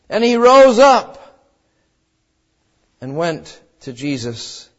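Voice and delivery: normal talking voice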